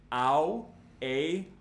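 ordinary talk, nothing marked

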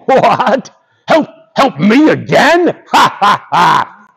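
incredulous lion, big mock laugh; scoffing lion. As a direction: laugh off the offer as a haughty lion.

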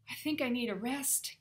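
a light gasp of needing a breather; tired out, with a little push. A narrator's reading. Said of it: "gasped Jack".

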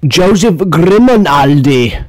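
slow, high, swooping announcement; dramatic name reveal. Pretend. Host revealing who created clowns, dramatic drawn-out name.

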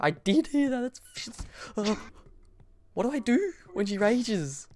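high, tense scared questions; anxious pleading for help; frightened, high voice. Real, not acted. Heard monster roar, asks what to do.

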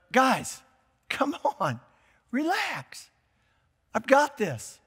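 a friend calming his buddies, lightly; easygoing reassurance. Retold or imitated. Jesus calming disciples in the boat, lightly casual. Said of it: "can you just hear him kind of almost like a, not being sarcastic".

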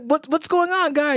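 high, eager retold hello; excited greeting. Retelling greeting Drago in the chat, excited greeting.

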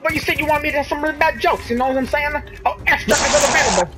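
high Brooklyn comedian; cheesy Brooklyn bad-joke guy; Brooklyn accent. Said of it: "now he has a Brooklyn accent".

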